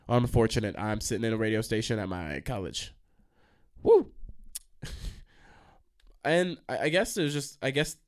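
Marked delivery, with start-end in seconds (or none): 3.8-4.1 loud, very high woo; whoop. Real, not acted. Radio host breaking a pause, loud high whoop. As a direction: let out one sharp high whoop.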